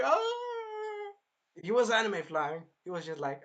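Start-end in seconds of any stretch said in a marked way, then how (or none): 0.0-1.1 very high, far-off pained cry; mock pained yelp. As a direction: yelp like an anime villain sent flying.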